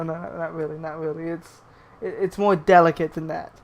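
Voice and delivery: ordinary talk, nothing marked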